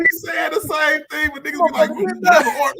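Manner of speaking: loud excited yell; shouting over everyone. Real, not acted. Heated friendly argument, yelling.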